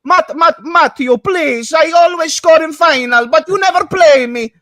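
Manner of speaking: high, loud pleading in mock Italian; Italian accent. Benched player begging to play, hammed-up Italian accent. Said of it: "that doesn't sound Italian".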